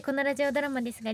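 usual speaking voice

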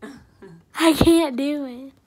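speaking through laughter; laughing while talking. Real, not acted. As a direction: mouth stretched wide open. Seen 0: No fingers in mouth; jaw gaping wide, then laughing smile.

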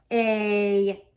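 ordinary delivery, nothing marked

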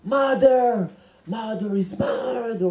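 drawn-out English sermon cry; mock a hollering preacher, stretching each word; imitated pulpit shouting, slowing right down; screaming American preacher. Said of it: "שצועק צרחות".

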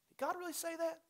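a high, sly, doubting voice; the enemy's voice. Acted. Pastor mimicking the enemy's doubt, pitched high. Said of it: "the two questions".

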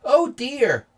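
high, loud worried gasp; alarmed and fretting. Acted. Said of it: "said Mark".

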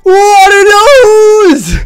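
high, loud celebratory holler; excited yell. Real, not acted. Streamer celebrating a shiny catch, excited high yell.

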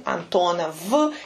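Antonov ending on a buzzed V; voiced final consonant. Shown as a demonstration. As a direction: say Antonov with a fully voiced V at the end, adding a slight uh. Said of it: "he made the last consonant sound D voice".

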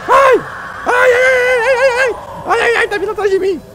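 high yelping ai ai ai, loud and real; screaming in fright. Real, not acted. Player chased by a sea monster, high frightened screams. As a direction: shriek as something gives chase.

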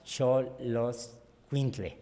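Xoloscuintle said slowly, syllable by syllable; careful slow pronunciation. Shown as a demonstration. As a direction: sound out Xoloscuintle carefully, as an unsure non-speaker. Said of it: "lo voy a pronunciar mal".

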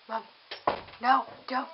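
ordinary talk, nothing marked

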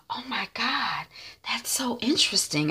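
soft, hushed gossip whisper; whispered reply. Retold or imitated. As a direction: whisper back as if sharing a secret.

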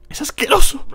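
high, loud cry of disgust; disgusted outburst. Acted. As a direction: blurt out your revulsion. Hero disgusted.

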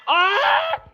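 high, loud startled scream; terrified yell; scream of fright. Real, not acted. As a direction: shriek at the jump scare. Jump scare in game.

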